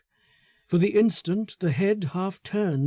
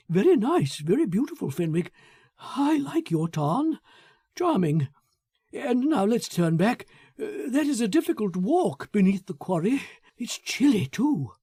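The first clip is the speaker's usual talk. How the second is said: nervous Foster; 5 semitones higher than usual. Acted. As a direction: pitch him up and let the dusk unsettle him. Foster brushing off Fenwick, wants back.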